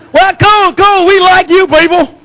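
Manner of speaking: the cop suddenly warms to him, lightly voiced. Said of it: "he looked at me and go".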